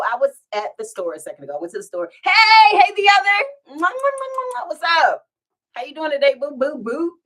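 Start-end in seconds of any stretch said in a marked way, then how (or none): 2.2-7.1 high loud cooing greeting with kisses; excited hello. Real, not acted. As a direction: gush over a friend.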